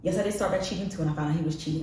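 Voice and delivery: ordinary talk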